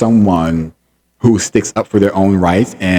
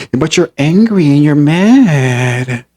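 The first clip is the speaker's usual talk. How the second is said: slow, high, drawn-out taunt; mock him, stretching the last word; singsong teasing imitation, pitched up; facetious mimicry; 6 semitones higher, much slower than usual. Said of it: "he's very facetious".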